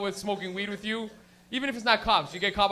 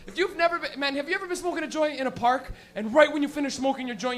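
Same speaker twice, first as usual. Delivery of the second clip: ordinary delivery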